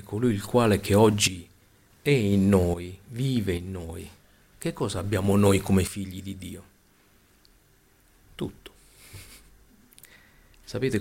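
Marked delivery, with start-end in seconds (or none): none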